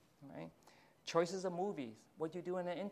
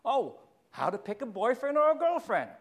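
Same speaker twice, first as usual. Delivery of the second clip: mock eager question; 7 semitones higher, 10 dB louder than usual. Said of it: "So you ask questions like that".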